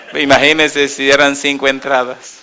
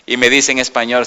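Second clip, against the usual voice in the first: usual speaking voice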